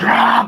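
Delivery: high, loud mock scream; imitated scream. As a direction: yell like a bad guy in a crash. Bad guys screaming in crash.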